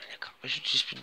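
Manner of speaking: sly whispered aside. Real, not acted. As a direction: whisper it knowingly. Whispered mock reply.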